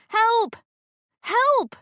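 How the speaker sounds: high, loud cry for help; frantic woman yelling help from a cliff edge; yelled for help. Acted. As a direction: scream for help to anyone near. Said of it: "Bernie yelled".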